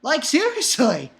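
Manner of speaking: high-pitched, incredulous protest; exasperated. Acted. Reader fed up with Cohen's ego, exasperated. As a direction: swoop up high in baffled outrage.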